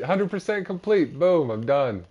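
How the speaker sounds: high, loud burst of celebration; excited triumph. Real, not acted. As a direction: crow over finishing the list. Celebrates completing the animal list.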